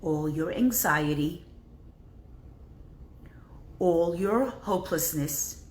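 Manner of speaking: a soft, unhurried meditation voice; guided breathing. Acted. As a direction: lead them to breathe it out gently.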